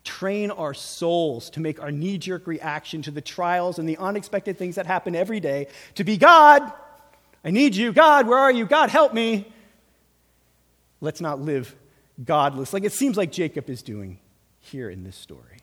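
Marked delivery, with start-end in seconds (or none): 6.2-9.5 desperate plea. Pretend. Voicing a desperate prayer.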